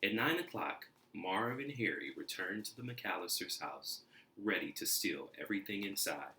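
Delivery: plain reading voice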